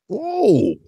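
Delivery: high, drawn-out stunned whoa; shocked whoa. Retold or imitated. Reacting to hateful comments, a high shocked whoa. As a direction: recoil in disbelief. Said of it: "you look at that shit like".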